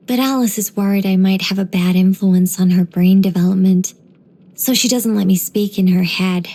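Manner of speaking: ordinary talk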